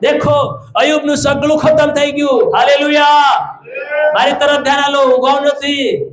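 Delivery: high, calling pulpit voice; forceful preaching. Real, not acted. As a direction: call out to rouse the congregation.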